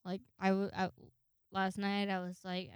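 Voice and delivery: ordinary talk, nothing marked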